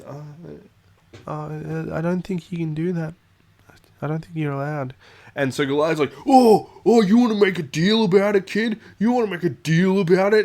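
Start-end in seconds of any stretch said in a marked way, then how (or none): 0.0-4.9 higher, hesitant, small-voiced objection; timid kid voice. Shy kid nervously objecting to the bully's reroll. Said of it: "And Frodo goes". 6.3-10.4 much higher and louder, spoiling for a fight; aggressive shout. Retold or imitated. Bully screaming in the shy kid's face, aggressive. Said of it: "screaming in his face".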